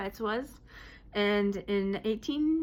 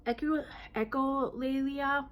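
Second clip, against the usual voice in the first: ordinary delivery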